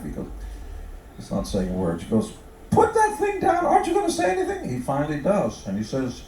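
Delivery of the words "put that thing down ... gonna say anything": high and fast, nagging the silent husband; impatient wife. Acted. Wife berating her silent husband, high and fast. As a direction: rattle it off up high like an exasperated wife.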